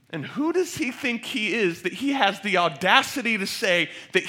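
loud, high, building sermon delivery; full-force preaching. Real, not acted. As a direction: preach it with mounting urgency to the whole room.

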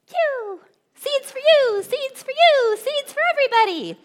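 very high, loud and chirpy; hammed-up high singsong; playful singsong call. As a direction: fling it out like handfuls of seed. Acts out flinging seeds to all.

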